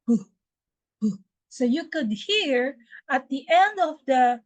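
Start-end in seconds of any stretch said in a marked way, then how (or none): 0.1-1.1 P, P with a burst of air at the end; P, P, explosive release; explosive P sound. Shown as a demonstration. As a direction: pop the lips apart on P.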